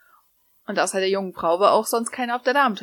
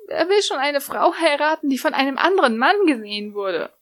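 higher, whining complaint; wailing lament; 6 semitones higher than usual. Acted. Lucy wailing to Natsu that no one will marry her now. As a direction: lament it like all is lost. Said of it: "jammerte Lucy".

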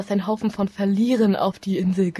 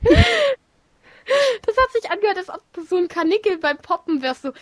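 laughing; 11 semitones higher than usual. Real, not acted. Laughing remark on a sound.

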